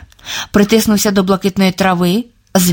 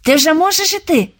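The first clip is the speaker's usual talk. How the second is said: glad question; 8 semitones higher than usual. A narrator's reading. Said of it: "радо запитав Сновида".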